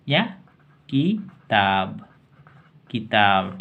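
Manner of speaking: किताब drawn out very slowly; slow drawn-out word. Shown as a demonstration. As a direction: stretch किताब syllable by syllable. (किताब को धीरे-धीरे खींचकर)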